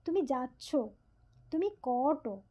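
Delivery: usual speaking voice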